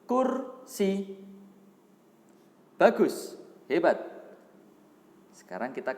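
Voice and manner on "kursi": high, drawn-out model word; sounding out words. Shown as a demonstration. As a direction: call the word out for the class to repeat. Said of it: "Sekali lagi, ikuti". (mengeja kata untuk ditirukan)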